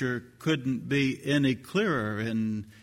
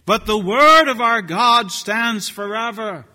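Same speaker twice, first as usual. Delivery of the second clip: much louder, higher declaration; ringing proclamation; 8 semitones higher, 10 dB louder than usual. Real, not acted. Preacher declaring to the congregation, loud ringing. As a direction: proclaim it with full force. Climax of sermon point.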